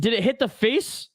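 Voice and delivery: ordinary talk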